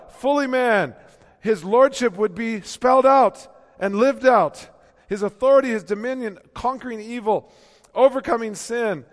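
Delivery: loud, high, ringing preacher's climb; full-voiced pulpit proclamation; preaching at full force. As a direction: lift it up and proclaim.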